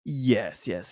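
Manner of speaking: usual speaking voice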